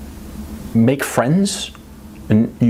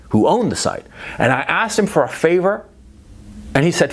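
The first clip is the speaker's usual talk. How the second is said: ordinary talk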